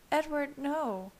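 slow, high-pitched plea to stop; Bella protesting. A narrator's reading. Bella's protest within Edward's narration.

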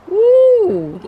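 high, loud exaggerated gasp of shock; mock shocked ooh. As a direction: squeal it like you can't believe it. Mock shock at pastor's name.

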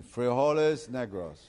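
put-on slow, reluctant repeat in American-accented Spanish. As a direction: stretch the vowels like an American beginner. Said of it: "George tiene que decir".

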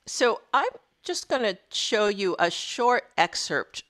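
ordinary delivery

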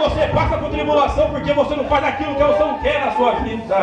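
fiery preaching. Acted. Pastor exhorting the congregation, loud fervent preaching. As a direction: preach it to the church at full force.

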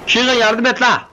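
high, loud call to a teammate; yelling for help. Real, not acted. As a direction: shout to Keegan for backup, pitch jumping up. Calling teammate for help mid-fight. (yükselen sesle yardım çağrısı)

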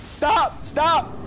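high, overwhelmed playful begging; mock pleading. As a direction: repeat stop in a high, pleading voice. Playfully begs finisher to stop.

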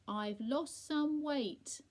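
slow, loud, high-pitched announcement; speaking up. A narrator's reading. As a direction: spell it out for someone hard of hearing. Speaking to deaf Percy.

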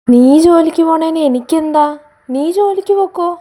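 loud, high defiant shout; angry shout. Acted. Wife shouting back at her husband, defiant. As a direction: fling it back at him loudly.

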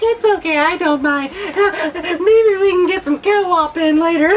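high, laughing fake-tender voice; mocking cuddly fanboy. Mocking a fanboy cuddling his favorite company, high and laughing. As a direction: coo it like a fan forgiving a company.

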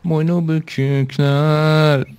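slow, dragged-out sulky voice; mock droopy drawl. Guest answering his intro in a slow sulky put-on voice. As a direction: droop the words out slowly.

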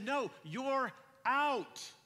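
drawn-out, slow, loud insistence; firm loud insisting. Retold or imitated. Father insisting to his son that he's out, loud and slow. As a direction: stretch it out, firmly.

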